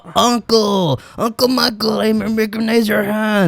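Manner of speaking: high, drawn-out mock little girl; hammed-up high voice calling out slowly to uncle. Retelling the girl recognizing her uncle, mock little girl. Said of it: "she was like".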